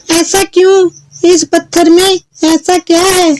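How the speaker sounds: put-on very high, puzzled girl voice; questioning Chulbul. Girl asks fairy about the stone, high questioning voice. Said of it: "फिर चुलबुल ने".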